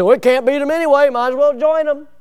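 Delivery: high, loud, fast shrugging voice; sloppy soldiers shrugging off the danger, high and fast; careless soldiers. Acted. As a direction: toss it off carelessly.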